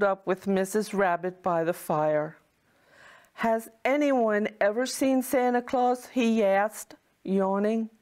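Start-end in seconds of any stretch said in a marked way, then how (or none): none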